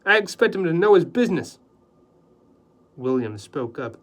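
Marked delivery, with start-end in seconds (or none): 0.0-1.6 indignant. Acted. Husband bristles at critic remark.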